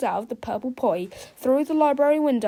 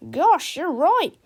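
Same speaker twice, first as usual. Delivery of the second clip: amazed; 7 semitones higher, 8 dB louder, slower than usual. A narrator's reading. Teacher convinced.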